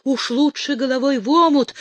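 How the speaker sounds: high-pitched cry of hatred; hateful outcry. A narrator's reading. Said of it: "с ненавистью глядя на таможенника, выкрикнула".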